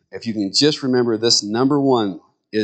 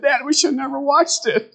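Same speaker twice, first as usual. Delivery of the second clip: put-on high, regretful little-girl voice; imitating daughters; 13 semitones higher than usual. Daughters coming home upset to their father, high regretful whine. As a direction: play the kids confessing their mistake. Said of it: "They came home and said".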